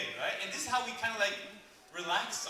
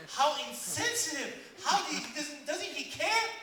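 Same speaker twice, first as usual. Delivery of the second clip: loud, high mock outrage; indignant wife; 9 semitones higher, 7 dB louder, slower than usual. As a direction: protest as the wounded wife, voice climbing. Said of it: "she looks at him like".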